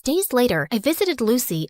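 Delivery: usual speaking voice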